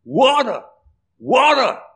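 high, rising gasped calls for water; panting thirsty deer. Acted. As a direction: cry out like a parched hunted deer. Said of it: "And it's saying".

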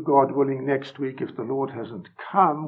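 usual speaking voice

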